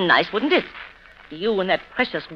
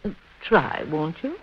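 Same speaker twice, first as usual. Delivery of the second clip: hushed, soft bedside reassurance; soft soothing; 4 semitones lower, 6 dB quieter than usual. Acted. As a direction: lull her to sleep gently. Sarah soothes the aunt.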